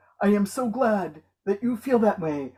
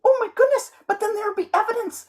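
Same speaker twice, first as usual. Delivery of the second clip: high, squealing melodramatic lover; hammed-up swooning Romeo in a high voice; showing off; 7 semitones higher than usual. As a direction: overact the panic, way up high. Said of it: "you're showing off".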